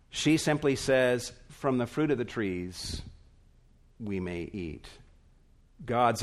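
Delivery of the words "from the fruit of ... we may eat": softer and lower, fading away; flat, deflated quote. Retold or imitated. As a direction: say it as a bare, diminished afterthought. Eve simply says, reduced version.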